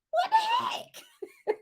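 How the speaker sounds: high, slow, laughing cry of disbelief. Real, not acted. Vlogger cracking up at the gossip, laughing squeal. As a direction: stretch it out through laughter.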